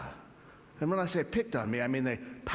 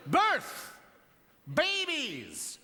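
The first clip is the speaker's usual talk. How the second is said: announcer; 11 semitones higher, slower than usual. Acted. Announcing the next comic.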